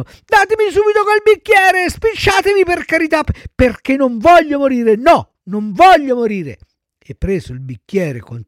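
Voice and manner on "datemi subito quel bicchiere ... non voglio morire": put-on high, urgent childish pleading; panicked shrieking. A narrator's reading. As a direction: beg frantically for your life. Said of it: "cominciò allora a strillare il burattino". (supplica strillata, voce acuta)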